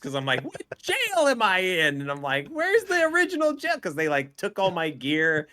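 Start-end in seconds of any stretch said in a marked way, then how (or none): 0.4-2.0 high, loud, exasperated question; worked-up mock wail; frustrated outcry. Reenacts frustration at being jailed. 2.5-3.8 very high, loud wail; exasperated high-pitched yell; frustrated outcry. Retold or imitated. Reenacts frustration at being jailed.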